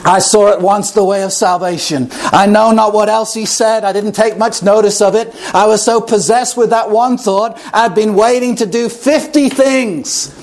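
usual speaking voice

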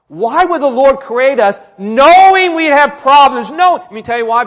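forceful preaching. Real, not acted. Preacher asking congregation why God created, forceful. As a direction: press the question home.

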